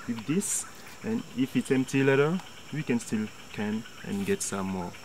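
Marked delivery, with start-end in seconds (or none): none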